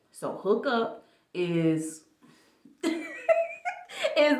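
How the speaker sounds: giggling through the words; laughing. Real, not acted. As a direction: break into an embarrassed laugh. Embarrassed laugh over the word.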